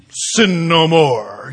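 slow, booming epic-movie pronouncement; Yul Brynner style. Retold or imitated. Preacher doing a grand movie Jesus, slow and loud. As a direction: intone it like an old Bible epic star. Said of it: "it could be Yul Brynner".

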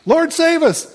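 very high-pitched cry to God; acted urgent appeal for rescue; crowd's plea. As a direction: call it out high and pleading. Acting the crowd's cry to Jesus.

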